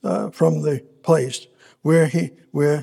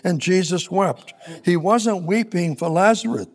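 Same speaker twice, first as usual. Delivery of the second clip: ordinary delivery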